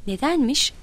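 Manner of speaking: wanting to be let in on it; curious. A narrator's reading. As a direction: ask with open curiosity.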